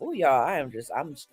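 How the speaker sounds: ordinary talk, nothing marked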